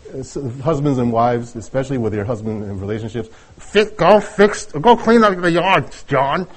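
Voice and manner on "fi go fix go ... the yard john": high, slow, insistent order; nagging wife. Acted. Wife telling husband to clean up the yard, mock nagging. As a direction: play a wife ordering her husband about.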